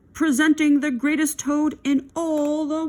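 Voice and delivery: high, loud announcer voice; strange faraway voice. A narrator's reading. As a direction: announce it grandly from afar. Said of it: "A strange voice from far away said".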